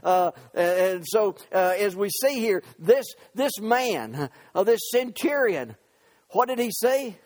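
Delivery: full-force preaching. Real, not acted. Preacher to congregation on the centurion, full-force preaching. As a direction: preach it out with punched words.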